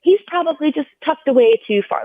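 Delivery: high, upbeat imitation of a nurse making excuses; breezy nurse imitation. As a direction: mimic a nurse cheerfully explaining it away. Nurse explaining away missing heartbeat.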